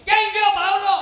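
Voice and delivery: wife yelling at her husband as he walks in; wife's angry tone. Retold or imitated. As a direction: snap at him like a fed-up wife. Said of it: "તો tone ફરી દે".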